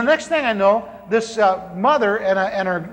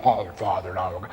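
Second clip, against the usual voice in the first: much lower, sanctimonious "Oh, Father"; mock pious prayer; 14 semitones lower, 6 dB quieter than usual. Mocking the churchgoers, a low pious prayer voice. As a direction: parody a preacher's plea.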